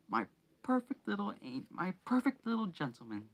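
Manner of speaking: sniffing aunt. A narrator's reading.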